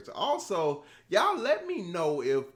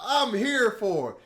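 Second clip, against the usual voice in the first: ordinary talk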